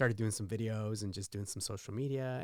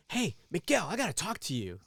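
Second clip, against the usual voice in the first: lady calling out; 6 semitones higher, 4 dB louder than usual. Retold or imitated. Retired lady calling him over.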